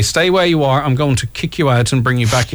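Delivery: ordinary talk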